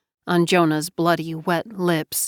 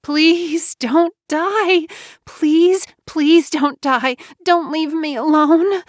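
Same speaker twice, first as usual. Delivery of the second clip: higher, louder, slow tearful begging; woman begging her dying friend not to die; desperate begging; 9 semitones higher, 4 dB louder, slower than usual. Acted. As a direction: plead through sobs.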